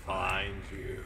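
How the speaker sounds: slow, drawn-out monotone threat, lightly menacing; half-hearted spooky demon voice, stretch the vowels; unintimidating menace. Acted. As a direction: play at menace without really scaring anyone. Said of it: "That voice is so unintimidating".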